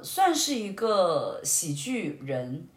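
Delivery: ordinary talk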